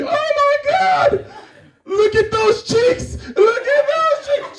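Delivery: put-on very high, drawn-out baby-adoring shriek; gushing squeal. Woman spots a baby's cheeks, high gushing squeal. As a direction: squeal it slowly in a high falsetto.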